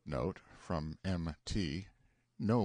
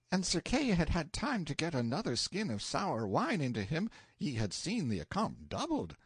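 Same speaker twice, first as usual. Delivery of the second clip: mocking page voice, pitched up; 7 semitones higher than usual. A narrator's reading. Page mocking the narrator's praise of Launcelot, mocking. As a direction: tease it lightly, a mocking youth. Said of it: "this mocking featherhead only said".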